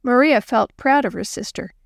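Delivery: ordinary delivery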